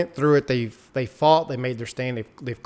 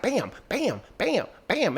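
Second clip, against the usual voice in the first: high-pitched popped "bam" for each shot; imitated gunshots; 8 semitones higher, 4 dB quieter, slower than usual. Fan acting out a hero firing a pistol, imitated gunfire. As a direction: punch out each shot high without shouting.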